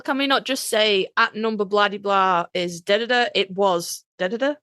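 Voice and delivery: ordinary talk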